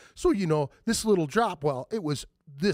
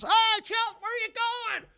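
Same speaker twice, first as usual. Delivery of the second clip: calling out from the porch; slower than usual. Retold or imitated. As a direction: shout it to him from far off. Crowd calling across street.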